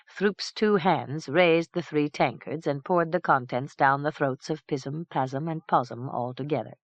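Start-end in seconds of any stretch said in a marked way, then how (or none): none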